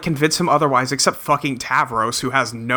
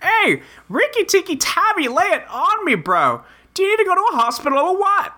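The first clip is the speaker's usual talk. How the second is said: frantic concern; 13 semitones higher than usual. Acted.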